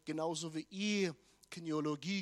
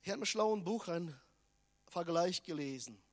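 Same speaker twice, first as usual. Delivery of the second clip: ordinary talk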